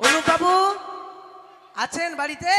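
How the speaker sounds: loud hail to someone indoors; calling out. Acted. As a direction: call for the householder from outside. Calling at a house door.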